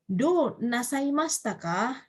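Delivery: slow teaching read. Shown as a demonstration. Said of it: "Atau misalnya".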